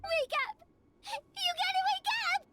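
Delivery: high, loud plea; urgent rousing shout. Acted. Urging someone they gotta wake up, urgent shout. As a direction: shout to rouse someone, desperately.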